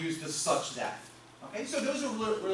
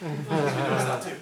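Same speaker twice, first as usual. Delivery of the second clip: chuckling through a teasing line; laughing tease; 2 semitones lower, 7 dB louder than usual. Real, not acted. Teacher joking with class about guesses, laughing. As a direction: laugh while you rib the students.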